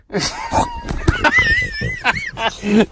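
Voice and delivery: high squealing burst of laughter; big shrieking laugh. Real, not acted. Owner pinned under the dog, shrieking laughter.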